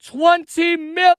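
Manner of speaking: angry rising rant, shouting; building from heated to yelling; escalating comedy rant. Real, not acted. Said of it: "Damn, he's mad".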